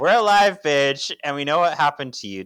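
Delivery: loud and high, fierce and triumphant; defiant shout. Retold or imitated. Paige telling possessed Prue off, defiant shout. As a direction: shout it back at her with attitude. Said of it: "And Paige says".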